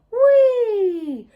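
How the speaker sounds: high, loud squeal of delight with a laugh; laughing whee of joy; gleeful whee. Acted. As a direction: squeal like a kid on a swing. Monkeys swinging on vines.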